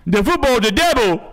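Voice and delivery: high, loud bayou mama voice; Waterboy mama voice. Retold or imitated. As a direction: yell it like a frantic bayou mom. Mama voice from Bobby Boucher bit.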